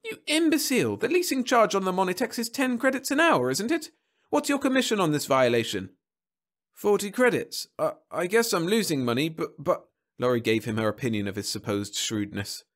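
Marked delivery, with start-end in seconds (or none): 0.0-5.9 very high, scolding woman's voice; screeching. A narrator's reading. Wife scolding husband over leasing charges, screeching. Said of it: "screeched Laurie". 6.7-9.9 high, faltering excuse; sheepish stammer. Acted. Husband admitting loss to angry wife, stammering.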